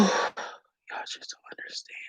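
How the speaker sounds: slow, breath-only whisper; real whisper. Real, not acted. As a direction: whisper it, overcome after crying. Overcome, whispers after crying.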